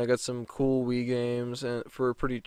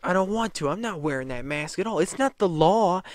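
mocking entitled refusal; bratty Karen; 6 semitones higher than usual. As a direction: sneer like a spoiled child. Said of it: "And the woman was like".

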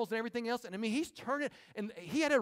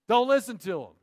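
Don't listen to 'em, much louder, punched on listen; loud repeat; 9 dB louder than usual. Shown as a demonstration. Preacher repeating Jesus' warning to the church, loud. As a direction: boom it out to the congregation. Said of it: "Don't listen to 'em".

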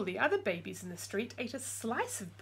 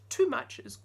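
stern warning; 5 semitones higher, 6 dB louder than usual. Acted.

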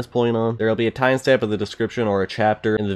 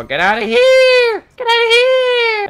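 shrieking; 9 dB louder than usual. Real, not acted. Yelling at enemies.

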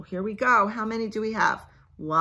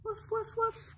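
high squeaky little woofs; puppy woof; 5 dB quieter than usual. Acted. Parent answering for the puppy, high squeaky woofs. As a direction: yip it like a tiny puppy. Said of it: "What does the puppy say?".

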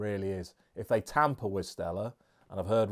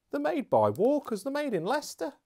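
amused disbelief. Real, not acted. Surprise at maker.

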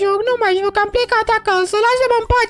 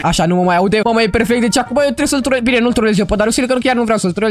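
deep pitched-down aside. Acted. Girl whispering plans to viewers in a pitched-down voice. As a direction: voice dropped far down, like an edited secret aside.

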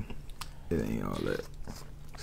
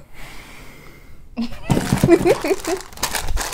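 high laugh; 4 dB louder than usual. Real, not acted. Laughing after the burp.

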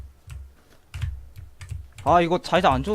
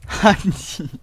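embarrassed laugh; 5 semitones higher, much slower than usual. Real, not acted. Laughing after the startled swearing.

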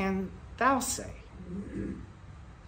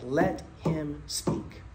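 calm minister; 4 semitones lower, much slower than usual. Retold or imitated. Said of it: "And the minister said".

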